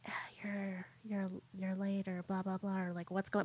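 hushed, unvoiced teacher's whisper. Teacher discreetly checking on a best student, whispered. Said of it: "like, whispers to her".

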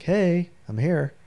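calling out. Retold or imitated. God announcing his presence, slow friendly call. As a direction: stretch it out like hailing someone.

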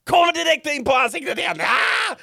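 high-pitched, fast, frantic retelling yell; exasperated shriek. Retold or imitated. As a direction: cry out as if a fly hits your face. Reliving fly landing on face. (gällt, uppjagat skrik)

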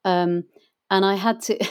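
ordinary talk, nothing marked

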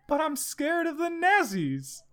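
put-on high, slow little-girl whimper; scared, near tears. A narrator's reading. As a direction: plead high and slowly, mock-tearful. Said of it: "almost ready to cry".

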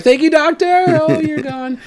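hammed-up politeness turning to surprise; mock surprise. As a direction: play it innocently polite, then caught out. Retelling the doctor vanishing.

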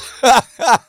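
short high burst of laughter; loud laugh. Real, not acted. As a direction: explode into a quick laugh. Laughing at punchline.

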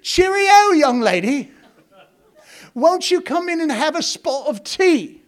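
high, loud, hammed-up British greeting; British accent. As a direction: imitate little girls playing British, calling out an invitation. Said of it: "they would speak British to each other".

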